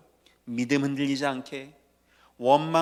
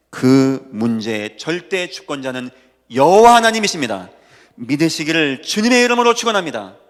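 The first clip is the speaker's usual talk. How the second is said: preaching at full force; 14 dB louder than usual. Acted. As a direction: pound each word out to the congregation. Climactic blessing proclamation.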